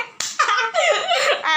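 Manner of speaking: shrieking high laugh. Real, not acted. Laughing at the sour challenge.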